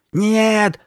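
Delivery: high, loud scream. A narrator's reading. Girl refusing his answer, screaming. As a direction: shriek it in refusal. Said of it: "закричала она".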